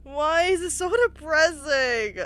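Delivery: real crying over the game's sad ending. Real, not acted. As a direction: speak through real tears. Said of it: "I'm crying".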